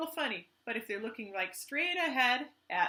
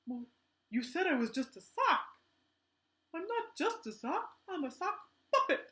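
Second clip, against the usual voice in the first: a higher, offended sock puppet voice; lightly voiced indignant puppet; 6 semitones higher than usual. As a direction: play the hurt little sock puppet. Sock puppet Herbert protests.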